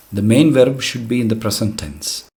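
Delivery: normal talking voice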